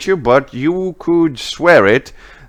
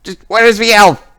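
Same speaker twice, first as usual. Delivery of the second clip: high, loud, demanding witch; Lilith; 10 semitones higher, 4 dB louder than usual. Acted. Witch interrogating the one in armor about the elf, high angry shout. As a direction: shout threats in a raised, higher voice. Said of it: "you can hear once again Lilith's voice".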